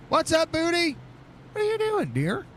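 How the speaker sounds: squeaky high hello to a friend; playful high-voiced hello; high sing-song greeting. Real, not acted.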